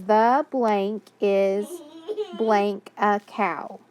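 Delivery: deliberately slow read-aloud. Shown as a demonstration. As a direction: read it out slowly for the class. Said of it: "It says".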